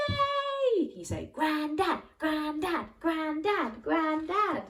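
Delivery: put-on a boy cheering as he finds Grandad; excited cheer. Jack thrilled to find Grandad.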